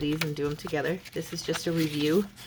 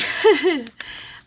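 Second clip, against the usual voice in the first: short high laugh; embarrassed laugh; 9 semitones higher, 6 dB louder than usual. Real, not acted. Laughs at own yawn.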